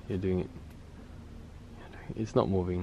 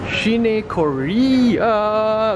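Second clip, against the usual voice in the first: excited drawn-out call; 9 semitones higher, 4 dB louder, much slower than usual. Real, not acted. Reading shop sign excitedly.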